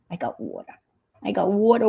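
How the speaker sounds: ordinary talk, nothing marked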